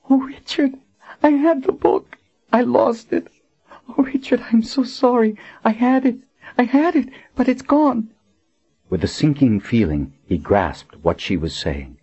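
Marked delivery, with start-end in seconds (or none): 0.1-8.1 high, tearful woman's voice full of apology; grieving woman. Acted. Dying Colin confesses to Richard she lost the book, tearful. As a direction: sob out the regret.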